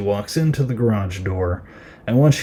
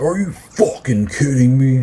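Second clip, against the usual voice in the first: higher, mock-outraged outburst; imitated angry disbelief, leaning on the swear; pissed-off dad; 4 semitones higher, 4 dB louder than usual. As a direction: act out a fed-up dad losing it. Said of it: "like, he is pissed".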